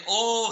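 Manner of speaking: oh lifted high and loud; stressed oh. Shown as a demonstration. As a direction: punch the single word oh. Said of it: "here is an emphasis".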